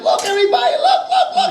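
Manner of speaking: put-on high, thrilled child calling out; high excited boy voice; excited kid. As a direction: show off the new toy, squealing high. Excited boy showing saxophone.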